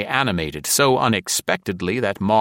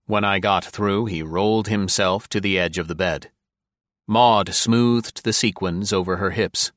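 usual speaking voice

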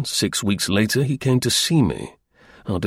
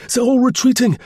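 much higher, louder panicked cry; raucous excited shout; 13 semitones higher, 5 dB louder than usual. Acted. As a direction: yell the bad news through the door. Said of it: "raucous with excitement".

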